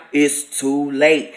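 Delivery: low, slow, booming voice from beyond; deep slow voice of judgment; otherworldly voice. Retold or imitated. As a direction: drop low and drag it out like a judgment from above. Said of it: "It wasn't in no regular tone".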